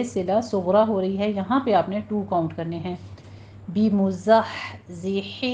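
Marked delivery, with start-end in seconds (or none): 3.7-5.5 بمزحزحہ very slowly, each ha kept distinct; careful recitation. Shown as a demonstration. Teacher reciting بمزحزحہ for students to practise. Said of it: "underline کر لیجیے اس word کو".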